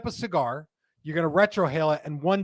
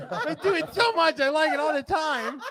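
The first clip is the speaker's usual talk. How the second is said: high whiny nerd voice; nerdy voice; 12 semitones higher than usual. Acted. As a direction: play a giddy dork. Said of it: "That voice is here to stay".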